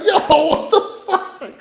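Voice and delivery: high, slow, laughing disbelief; reacting with laughing shock to the patient's insult.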